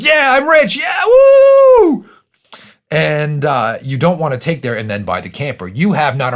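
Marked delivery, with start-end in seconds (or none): 0.0-2.1 shouted high whoop of joy; loud, high hammed-up woo; mock celebration. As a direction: ham up the new-rich cheer. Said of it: "you're like".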